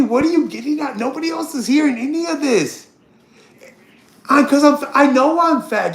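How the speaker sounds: ordinary delivery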